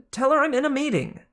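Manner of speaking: exasperated loud god; Hermes, exasperated. Acted. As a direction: wave off the caller. Hermes brushing off a call.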